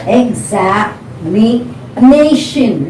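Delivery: examination very slowly, ex-za-mi-NA-tion; syllables with fourth stressed. Shown as a demonstration. Teacher showing learners the stress, examination split with NA stressed. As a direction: break examination into beats and land hard on NA. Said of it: "the stress is on the fourth syllable".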